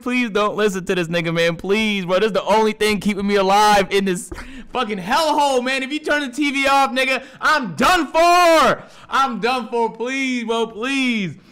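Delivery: loud, high, worked-up begging; desperate pleading. Acted. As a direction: beg as if your life depends on it. Begging to keep the TV on.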